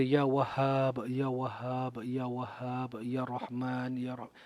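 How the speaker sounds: slow, hushed chant of God's names; soft zikir. Retold or imitated. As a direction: barely voice it, slow and devout. Repeating zikir alone.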